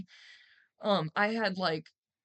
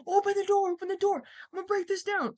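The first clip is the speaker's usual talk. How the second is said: loud, much higher yelling demand; furious high shout at the door; 11 semitones higher, 5 dB louder than usual. Retold or imitated. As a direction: bang on the door and yell. Shouting at locked bathroom door.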